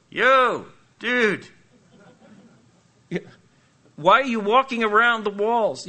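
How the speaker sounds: loud, high call down from the wall; mock yell from the wall. Guy on the wall heckling the marching Israelites, loud mock yell. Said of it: "I'd be going".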